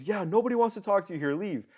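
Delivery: grumpy homeowner telling the salesman to leave, hostile; hostile neighbor. Retold or imitated. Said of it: "He goes".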